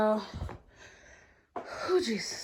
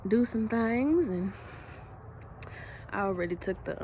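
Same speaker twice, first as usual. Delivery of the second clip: normal talking voice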